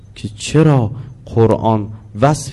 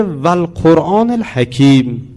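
slow intoned verse; Quran recitation; 4 semitones higher, slower than usual. Shown as a demonstration. Teacher quoting the verse to class, slow recitation. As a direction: recite it slowly, word by word. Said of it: "حالا میگه".

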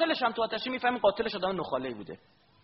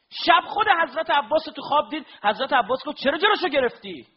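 higher, louder impassioned sermon; fervent preaching; 12 semitones higher, 10 dB louder than usual. Acted. Preacher stirring mourners with Abbas's story, fervent and loud. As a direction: preach it out with fire to the crowd.

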